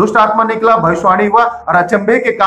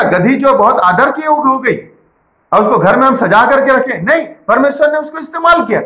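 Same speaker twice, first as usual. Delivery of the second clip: raised, ringing sermon voice; fervent preaching; 6 semitones higher than usual. Acted. Pastor preaching to believers about miracles, fervent and raised. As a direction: preach it out to the congregation.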